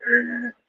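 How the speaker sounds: high, soft imitated screeching halt; plane stopping. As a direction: mimic a crashing plane suddenly stopping. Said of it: "it stopped and go".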